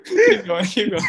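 loud, pushing them on; loud urging. Real, not acted. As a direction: call out keep going. Host urging guest to continue.

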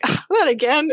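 high-pitched comic wail of dismay; mock dismay. Character left with half a plate of cookies, mock dismay.

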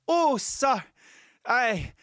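high, slow swooning plea; damsel in distress. Pretend. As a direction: play the helpless damsel, sighing. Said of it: "Like a damsel in distress".

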